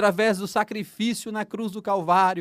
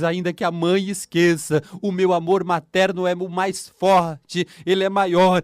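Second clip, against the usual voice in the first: preaching at full force; 7 dB louder than usual. Acted. Preacher urging listeners to accept God's comfort, fervent and loud. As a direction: urge them to open their hearts.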